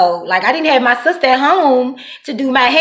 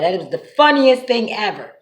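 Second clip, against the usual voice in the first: normal talking voice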